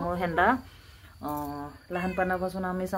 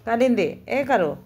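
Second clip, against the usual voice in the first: raised, higher calling voice; 4 semitones higher, 6 dB louder than usual. Real, not acted. Vlogger calls the cat Kalindi, calling out. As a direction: call out to the cat, pitched up.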